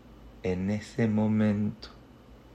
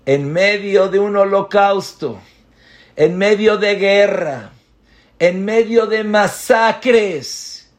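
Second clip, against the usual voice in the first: loud, high, slow preaching climax; preaching at full force; 10 semitones higher, 11 dB louder than usual. Acted. Rabbi drives the lesson home to the congregation, thundering. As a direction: hammer each line home.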